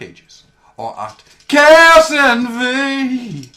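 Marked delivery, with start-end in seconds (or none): 1.5-3.5 loud, high, very slow plug; loud drawn-out. Pretend. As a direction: stretch it out like a big shout.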